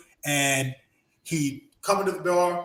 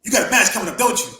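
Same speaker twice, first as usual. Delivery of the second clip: menacing taunt; 4 semitones higher, 6 dB louder, much faster than usual. Retold or imitated. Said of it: "He goes".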